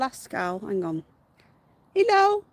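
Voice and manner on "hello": higher, louder cooing hello; pet greeting. Real, not acted. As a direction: call a bright hello to the pet.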